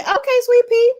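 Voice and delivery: squealed high and bright hello to a friend; light up at a friend's name; delighted shout-out to a viewer; excited greeting. Real, not acted.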